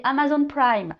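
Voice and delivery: French speaker lightly putting on English; a touch of playful English pronunciation; light English accent. Shown as a demonstration. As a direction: say the brand name with a slight English accent. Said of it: "je prends l'accent un peu anglais".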